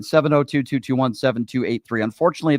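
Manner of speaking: ordinary delivery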